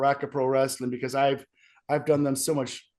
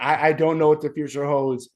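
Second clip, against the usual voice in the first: usual speaking voice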